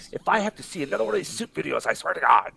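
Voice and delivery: mock outraged rant. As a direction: rattle off an exaggerated ultimatum. Mock rant, then says joking.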